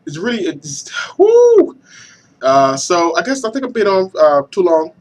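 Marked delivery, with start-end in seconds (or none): 1.2-1.8 high loud woo; excited whoop. Real, not acted. Hyped about the new console, loud high whoop. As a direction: let out a big cheer. Hype cheer.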